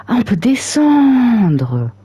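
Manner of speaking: loud, high, drawn-out exclamation; surprised discovery. Real, not acted. Player finds out the menu can scroll down, surprised. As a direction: stretch out a loud, high cry of realisation.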